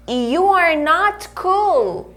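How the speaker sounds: slow, careful full form; slow model sentence. Shown as a demonstration. Teacher modelling the full form slowly. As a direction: spell the sentence out for learners. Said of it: "tanto faz eu falar".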